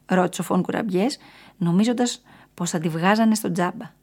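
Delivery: ordinary delivery, nothing marked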